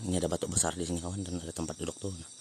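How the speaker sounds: ordinary talk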